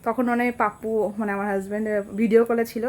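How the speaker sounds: normal talking voice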